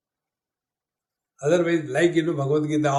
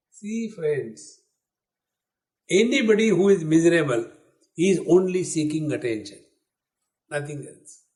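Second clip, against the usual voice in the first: usual speaking voice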